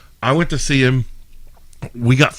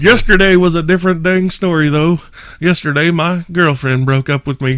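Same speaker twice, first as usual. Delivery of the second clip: somewhat high, louder mock-sad setup; comedian's setup; 7 semitones higher, 4 dB louder than usual. Retelling the breakup joke in a raised stage voice. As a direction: set up the sad bit.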